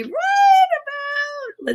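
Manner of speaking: very high, stretched-out words; drawn-out searching squeal. Real, not acted. Host hunting for page sixty-seven, high drawn-out squeal. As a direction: hold it high and long while searching.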